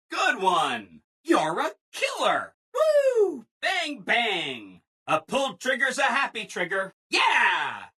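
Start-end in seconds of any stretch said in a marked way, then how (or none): 2.7-3.4 very high, loud "woo" of delight; gleeful whoop. Acted. Robot cheering on a killer shot, gleeful whoop. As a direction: whoop with sky-high glee.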